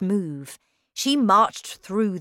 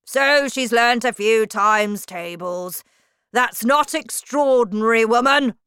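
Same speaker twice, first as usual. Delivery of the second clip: loud, higher, contemptuous bark; scornful shout; 5 semitones higher, 8 dB louder than usual. A narrator's reading. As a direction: crush the teacher's praise with scorn. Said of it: "shouted Miss Trunchbull".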